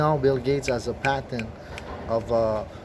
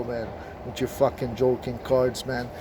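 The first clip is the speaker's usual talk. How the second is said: ordinary talk, nothing marked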